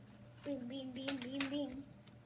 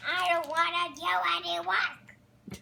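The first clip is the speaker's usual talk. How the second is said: loud puppet refusal; 7 dB louder than usual. Pretend.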